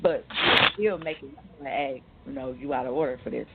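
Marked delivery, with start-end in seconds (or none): none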